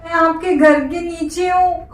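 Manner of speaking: put-on sobbing, voice wobbling; fake crying. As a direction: sob it out to win mother's pity. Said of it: "ये मेरा नाटक है".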